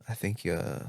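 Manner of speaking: soft, halting mock therapist; hesitant therapist. Acting a future AI-reliant therapist.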